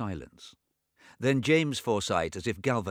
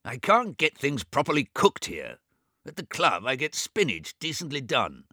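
angry; 6 semitones higher, 4 dB louder than usual. Acted. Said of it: "Swithin Forsyte said angrily".

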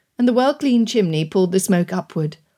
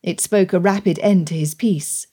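usual speaking voice

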